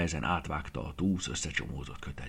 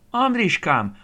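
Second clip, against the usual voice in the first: much higher and louder, a worried grandmother's cry; 13 semitones higher, 10 dB louder, slower than usual. A narrator's reading. Grandmother calling to her grandson who came home late, loud anxious cry. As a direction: call the boy's name out, relieved and cross at once. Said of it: "kiáltott fel Aranka néni".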